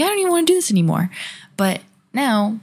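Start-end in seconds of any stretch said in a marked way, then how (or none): 0.0-1.1 high, fast, exasperated whine; despairing whine. Retold or imitated. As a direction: blurt it out in overwhelmed despair. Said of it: "be like".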